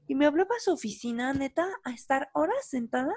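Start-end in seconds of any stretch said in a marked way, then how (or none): none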